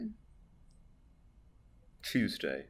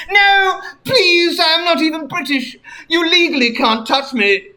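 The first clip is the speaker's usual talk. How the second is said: high, panicky begging; pleading high voice; 10 semitones higher than usual. Acted. Captive begging the Queen not to touch him, squeaky panic. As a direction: beg for your life in a squeaky voice.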